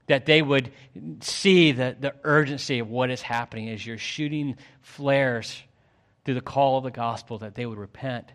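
earnest public prayer, swelling then settling; fervent prayer. Real, not acted. Pastor praying for those who rejected the word, fervent prayer. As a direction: pray aloud with rising fervour, then ease down.

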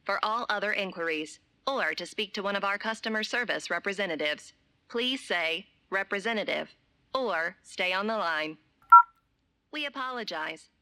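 automated recorded menu voice; robotic hotline recording, evenly paced; phone menu voice. Acted.

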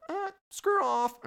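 very high slow squawk; rude parrot retort. Pretend. Parrot snaps back at the stage host, very high slow squawk. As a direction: brush him off like a grumpy parrot.

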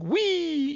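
very high, drawn-out squeal; imitated video "wee". Driver imitating a TikTok video, high squealed wee. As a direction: squeal it like someone on a slide. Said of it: "that thing where it's like".

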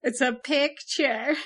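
flat Midwestern a in picture; Midwest accent. Acted. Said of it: "Midwest accent, here it is".

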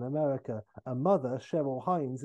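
ordinary talk, nothing marked

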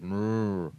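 imitated muffled amp hum, "mrrr"; drawn-out wordless murmur imitation; muffled voice noise. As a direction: mimic a garbled voice through an amp. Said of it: "like, just muffled".

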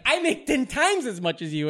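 defiant boast. Retold or imitated. Husband boasts to magician.

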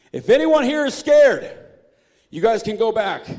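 loud, high call to the whole crowd. Retold or imitated. Gideon calling out to thousands of men, loud shout. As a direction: shout it across the camp.

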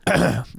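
loud throat clear into mic. Real, not acted. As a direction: clear your throat hard. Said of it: "coughing loud into the mic".

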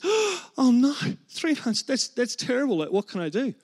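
high-pitched mock dismay; shocked patient. Patient told by doctor of three months to live, mock shock. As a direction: play a distraught patient in a joke, voice jumping up. Patient hears she is dying.

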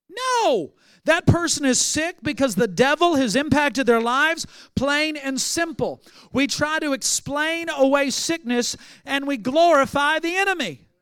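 high and loud, hammering each phrase; forceful preaching. Real, not acted. Preacher refuting the idea that God makes people sick, fiery. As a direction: preach it with full conviction. Preacher rejecting false teaching.

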